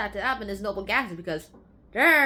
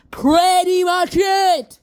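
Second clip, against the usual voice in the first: triumphant shout; 8 semitones higher, 12 dB louder, much slower than usual. Acted. Big finale.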